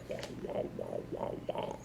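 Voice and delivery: breathy unvoiced wah wah; soft whispered drone. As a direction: mouth the garbled voice in a whisper. Customer's garbled voice again.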